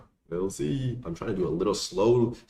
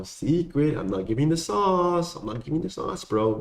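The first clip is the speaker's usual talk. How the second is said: teasing; 9 semitones higher, 3 dB louder, slower than usual. Real, not acted. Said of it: "teasing refusal to share workout".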